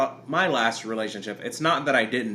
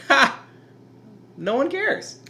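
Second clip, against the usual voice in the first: mocking; 13 semitones higher than usual. Mock self-dismissive quote.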